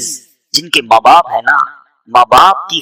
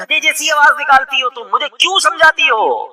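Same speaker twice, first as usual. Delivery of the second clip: mocking son's retort; 10 semitones higher, 3 dB quieter than usual. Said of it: "ماں کو کہتا تھا".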